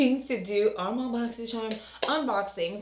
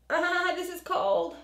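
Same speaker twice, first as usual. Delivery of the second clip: higher, slow gasp at cold; surprised by cold; 8 semitones higher, slower than usual. Real, not acted. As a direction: react to the cold mask. Cold mask on her face.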